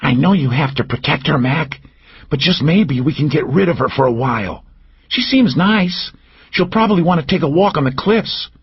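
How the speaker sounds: Cal's high, conspiratorial stage whisper; mock-secret whisper to Mac; loud stage whisper. A narrator's reading. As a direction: lean in and whisper so the room hears. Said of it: "whispered in a very loud stage voice".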